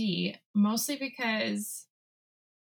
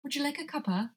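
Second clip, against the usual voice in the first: a quick British accent, pitched up; 7 semitones higher, 3 dB quieter, faster than usual. Shown as a demonstration. Friend offering tea in a British accent. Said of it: "that was more of a British accent".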